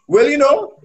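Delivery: imitating Oral Tracy. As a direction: open like the veteran commentator. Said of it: "start every commentary by saying".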